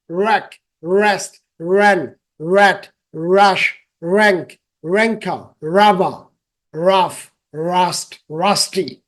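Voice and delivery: words like rang, red and rush with a curled, untouched-tongue r; English r drill. Shown as a demonstration. Teacher drills word list, tongue never touching. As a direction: read রিং to rude as a list, each r rounded. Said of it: "একবারও আমার জিহ্বা লাগবে না".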